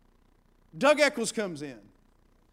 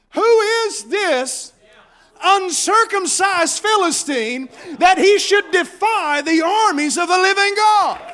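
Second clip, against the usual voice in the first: slow, loud, higher defiant cry; defiant challenge; 8 semitones higher, 9 dB louder than usual. Retold or imitated. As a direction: call out the giant with holy boldness. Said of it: "he said".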